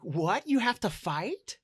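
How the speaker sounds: high, startled, worried question; concerned. Acted. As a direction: ask it alarmed for a friend. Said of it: "Scout then becomes concerned".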